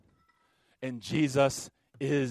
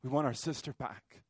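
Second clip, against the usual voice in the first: much softer, hushed repeat of the plea; quiet grief; 7 dB quieter than usual. Real, not acted. Preacher echoing the prayer for his sister, soft and grieving. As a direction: let it fall to a grieving hush. Quiet echo after the rage.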